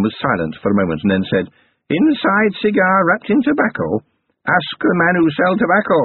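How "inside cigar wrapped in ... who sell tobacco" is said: haggling goblin crone. A narrator's reading. Goblin granny naming her price to Carrot, sly high crone. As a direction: bargain like a sly old goblin.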